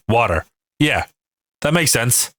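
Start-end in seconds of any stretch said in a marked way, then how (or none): 0.1-0.4 "water" said as "wadder". Shown as a demonstration. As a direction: say "water" with a D in the middle and a full R. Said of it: "Instead of the T, it's a D".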